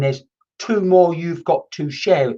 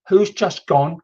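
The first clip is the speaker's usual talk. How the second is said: ordinary delivery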